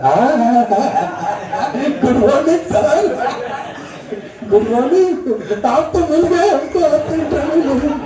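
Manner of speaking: gushing, laughing mock greeting, pitched high; overdone smiling greeting. Shown as a demonstration. Coach mocking a candidate grinning too much at the interviewer, gushing and giggly. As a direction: ham up a giggly, far too delighted candidate. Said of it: "ऐसा वाला मत करो".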